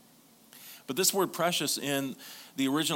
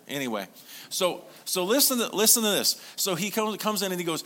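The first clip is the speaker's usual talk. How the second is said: full-force preaching; 4 semitones higher than usual. Acted. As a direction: preach it out to the whole room.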